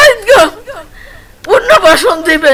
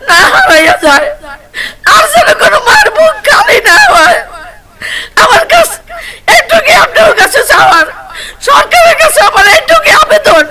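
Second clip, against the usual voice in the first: hit hard crying out; 9 semitones higher than usual. Real, not acted. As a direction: wail the demand through tears. Mother sobbing demands to government.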